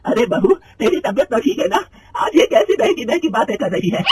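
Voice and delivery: mother-in-law scolds in disbelief; angry mother-in-law scolding her daughter-in-law. Acted. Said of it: "गुस्से में कहती है".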